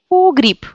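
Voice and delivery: "Погреб" said as "pogrip"; "Погреб" with stressed O and a final P; Russian devoicing. Shown as a demonstration. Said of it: "Y sans accent devient I".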